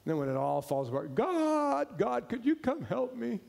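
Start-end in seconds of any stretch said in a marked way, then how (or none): 1.2-3.4 high, pleading call for help; mock desperate plea. Believer begging God after everything falls apart, pleading high. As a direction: cry out to God when it all falls apart.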